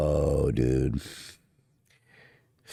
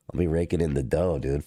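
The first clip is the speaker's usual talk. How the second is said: normal talking voice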